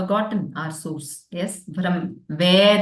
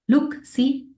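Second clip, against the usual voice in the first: higher-pitched call to someone; mildly acted summons, pitched up; 7 semitones higher than usual. Father calling the mother over to see their son, calling out.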